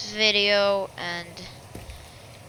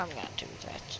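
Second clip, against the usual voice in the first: whispering; 6 semitones lower, 20 dB quieter, much faster than usual. Acted. Plotting to burn building.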